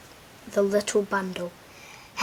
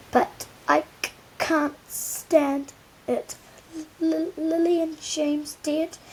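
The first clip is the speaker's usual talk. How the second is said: higher, slow, stammering grief; weeping giant; 7 semitones higher, slower than usual. Acted. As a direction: blubber through the tears. Hagrid weeping over Harry.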